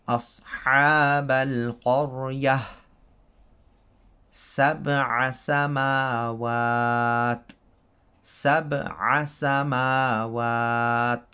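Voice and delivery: slow melodic Quran recitation; تلاوة القرآن; Quranic recitation. Shown as a demonstration. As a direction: chant the verse slowly. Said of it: "reads Quran examples".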